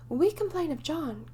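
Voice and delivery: eager tattling cry; cried out. A narrator's reading. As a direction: twins tattling together. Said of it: "cried the twins".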